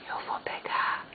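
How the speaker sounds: soft whisper; whispered aside. Real, not acted. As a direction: breathe it quietly to the pet. Whispering to the pet.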